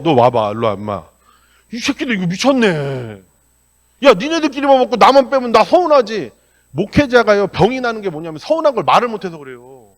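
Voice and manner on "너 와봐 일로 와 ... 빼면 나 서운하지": put-on loud scolding of the kids; retold angry scolding. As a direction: call them over and tell them off, angrily. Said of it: "거기서 화를 내요".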